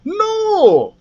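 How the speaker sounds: high, drawn-out no; dragon's denial. A narrator's reading. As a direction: let the word swoop down from high. Dragon admits he cannot fly.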